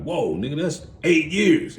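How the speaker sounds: high, slow stunned disbelief; gasping at the sentence; shocked realization. Retold or imitated. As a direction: stretch out the shock at eight years.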